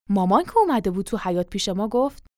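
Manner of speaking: ordinary delivery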